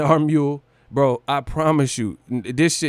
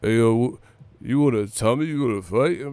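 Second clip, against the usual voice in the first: Rocky impression; 3 semitones lower than usual. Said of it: "I'll do a Rocky impression".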